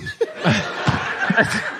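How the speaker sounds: breathy burst of laughter; real helpless giggle; cracking up. Real, not acted. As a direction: lose it at your own story. Cracks up at own quote.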